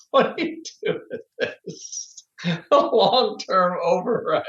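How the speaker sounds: words breaking up into breathless giggles; real helpless laughter. Real, not acted. Co-host can't stop laughing at the one dollar stock, laughing. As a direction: laugh through the words, losing the voice. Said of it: "I can't stop laughing".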